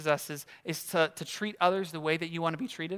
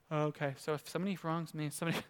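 much softer, working it out to himself; muttered calculating; 4 semitones lower, 11 dB quieter, faster than usual. Retold or imitated. Peter quietly recounting after Jesus' answer, muttering. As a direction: mumble the math under your breath. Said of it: "So Peter's like".

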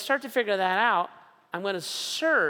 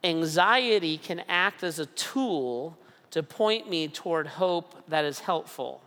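slow, weighty statement of the principle; measured declaration; slow and deliberate. Shown as a demonstration. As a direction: lay it out word by word. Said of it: "One principle is this".

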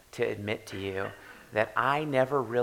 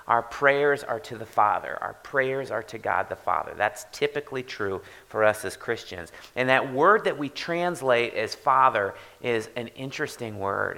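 normal talking voice

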